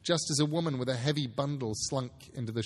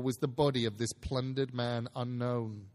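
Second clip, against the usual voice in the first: plain reading voice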